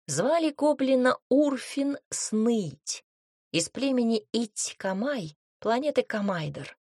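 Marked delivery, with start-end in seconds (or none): none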